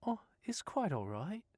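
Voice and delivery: put-on breezy Australian girl voice; easygoing Australian woman reassuring Stephen; Australian accent. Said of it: "hint of an Australian accent".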